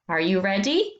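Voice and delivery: normal talking voice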